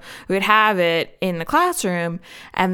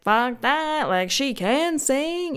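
mock impressed listener; 7 semitones higher, slower than usual. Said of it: "Be like".

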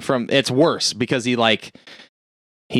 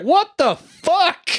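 slow, very high-pitched imitation of shock; mock shock; much slower than usual. Man suddenly sitting in another's lap, mock shock. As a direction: stretch it out, hammed-up and squealed high. John appears in Sutter's lap.

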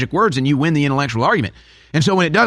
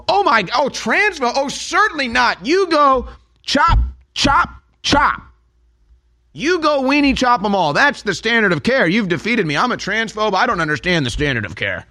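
high, hammed-up mock surrender; sarcastic capitulation; 9 semitones higher than usual. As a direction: gush a fake apology, dripping irony.

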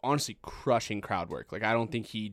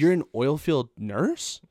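slow, louder, rising disbelief; incredulous question; 4 semitones higher, 6 dB louder, slower than usual. Retold or imitated. Comedian turning to the boyfriend, incredulous. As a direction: draw out the question in mock disbelief. Comedian mocking audience member's job.

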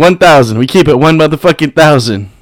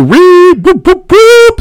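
siren whoop; 5 dB louder, much slower than usual. Real, not acted. Celebrating download record.

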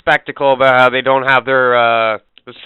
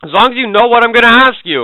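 mocking sarcasm; 9 semitones higher, 8 dB louder than usual. Mocking the clerk.

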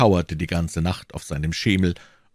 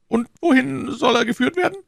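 very high-pitched official's voice; inquiring clerk; 4 dB louder than usual. Acted. Clerk asks in a put-on voice.

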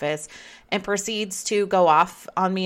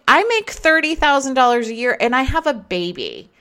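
higher, louder, exasperated; indignant retort; 9 semitones higher, 6 dB louder than usual. Retold or imitated. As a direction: fire it back at the boss, fed up. Retold clash with her boss.

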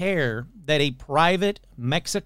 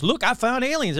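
mock excited announcement; 7 semitones higher than usual. Imitates hoaxer announcing his find anew.

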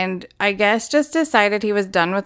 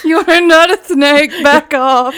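loud giggling push-back; laughing protest; 5 semitones higher, 6 dB louder than usual. Real, not acted. Co-host laughing at the snake impression, loud. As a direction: shout it through laughter.

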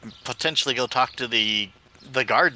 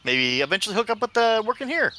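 pitched up, evasive little gnome; high gnome voice; 6 semitones higher than usual. Acted. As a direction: dodge the question brightly as a gnome. Gnome evades dwarf's question.

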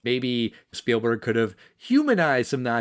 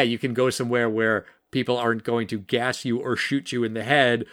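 usual speaking voice

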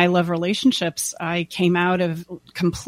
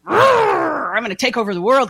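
higher, louder mock conqueror; mock grandiose; 7 semitones higher, 4 dB louder than usual. Mocking an ego power trip, mock grandiose. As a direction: puff it up like a would-be conqueror. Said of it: "that's not a".

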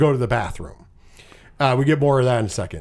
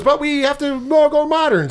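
much higher put-on chef voice; mock chef announcement; 10 semitones higher than usual. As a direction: lift into a grand, showy chef's pitch.

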